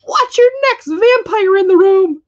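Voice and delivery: very high, loud, slow bird squawk; squawking parrot. Acted. Parrot warns the room about the vampire. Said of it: "flapped its wings and squawked".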